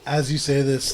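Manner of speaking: normal talking voice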